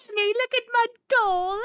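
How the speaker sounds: high, mock little British girl; little girl British accent. Imitating the girls from Keeper in a high British accent. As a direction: squeak it like a silly British child. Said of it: "little girls in it that have an accent".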